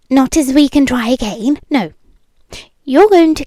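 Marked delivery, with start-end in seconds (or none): none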